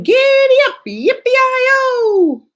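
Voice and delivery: high, loud cowboy whoop; cowboy yell. Acted. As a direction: holler it like a kid on a horse. Student dressed as cowboy.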